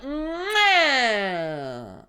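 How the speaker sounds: a high, smacking kid's kiss sound, mock-crying; imitated kid kiss. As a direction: blow a sloppy little kid's kiss, hammed-up. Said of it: "a kid would be like".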